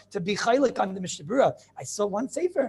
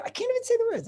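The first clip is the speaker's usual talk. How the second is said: exasperated high outburst; 14 semitones higher, faster than usual. Real, not acted. Rabbi is exasperated by the quote.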